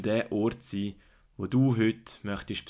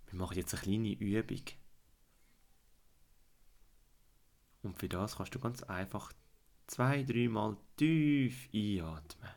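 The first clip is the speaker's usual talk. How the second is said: guided fantasy journey. Acted. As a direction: calmly lead a child into relaxation.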